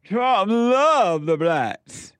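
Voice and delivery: high, very slow sneering sing-song; mocking impression. Mocking a PBS soundbite about Trump, high slow sneer. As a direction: drag "love" out high in ridicule. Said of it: "Here's another soundbite from P B S".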